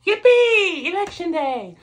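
high, sarcastic yippee; hammed-up mock celebration; mock cheer. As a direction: fake delight at election day. Mock cheer about election day.

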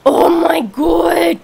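loud, slow exasperated shout; frustrated shout. Real, not acted. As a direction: let it out after another miss. Frustration at missing a bug.

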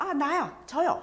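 eager student answering at once; eager volunteering. Retold or imitated. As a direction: jump in to volunteer. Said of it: "하는 식으로".

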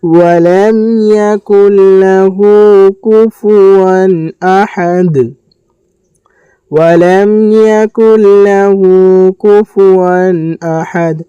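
slow melodic tajweed chant; measured Quranic recitation. Shown as a demonstration. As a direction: recite slowly, drawing out each vowel.

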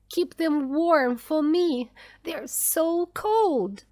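high plea, dragging at the end; crying out. A narrator's reading. Mother begging him to warm her children, anguished. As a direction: plead anxiously, drawing out the last words. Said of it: "cried Missus Dawson".

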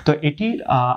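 ordinary talk, nothing marked

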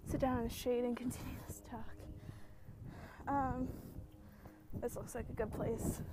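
breathy whisper while sitting down. Real, not acted. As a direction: drop to a whisper.

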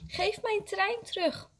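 high-pitched tearful demand, mildly acted; crying call. A narrator's reading. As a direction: wail for your toy back. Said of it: "Huilend ... en roept".

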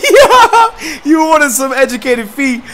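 high, loud laughing whoop; laughing cheer. Real, not acted. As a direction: cheer through laughter at the big kick. Cheering a comeback kick.

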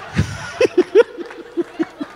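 high wheezy, voiceless laughter; laughing. Real, not acted. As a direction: dissolve into a breathless laugh. Laughing after recalling they laughed.

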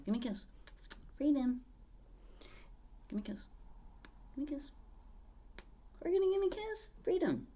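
high coaxing sing-song for the parrot; asking her parrot for a kiss in a high pet voice. Real, not acted. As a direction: coax the bird up high and sweet.

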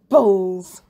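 loud satisfied balls; gleeful to himself. Acted. Teacher gloating after locking the cupboard, loud gleeful word. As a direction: savour the word. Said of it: "he said to himself".

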